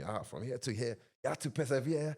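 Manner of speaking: put-on proud dad telling his struggle story; dad imitation. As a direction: imitate dad preaching about perseverance. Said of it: "like, telling me".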